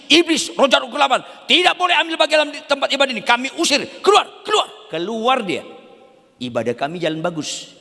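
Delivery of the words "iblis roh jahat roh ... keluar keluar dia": commanding deliverance prayer; fervent prayer shout. Acted. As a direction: drive the spirits out with full force. Acts out the church's exorcism prayer.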